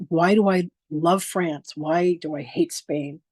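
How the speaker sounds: slow, deliberate sample questions; a teacher's slow, measured example questions. Shown as a demonstration. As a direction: lay out each example question slowly. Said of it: "like, why questions".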